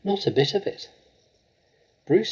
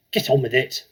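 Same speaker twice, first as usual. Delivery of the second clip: louder barked order; shout; 7 dB louder than usual. A narrator's reading. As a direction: bark it at him. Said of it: "she shouted".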